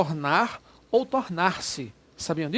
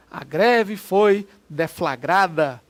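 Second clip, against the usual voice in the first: each word drawn out, louder; slow example sentence; 5 dB louder, much slower than usual. Shown as a demonstration. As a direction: read the example slowly for the class. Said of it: "teacher example sentence". (professor lê o exemplo devagar e mais alto)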